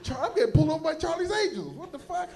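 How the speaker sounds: high, loud, laughing disbelief; pulled over by sexy cops, aghast; mock outrage.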